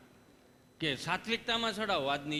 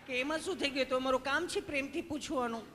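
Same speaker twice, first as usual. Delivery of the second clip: higher voice through light laughter; laughing sweet nurse; 6 semitones higher than usual. Retold or imitated. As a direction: giggle while explaining kindly.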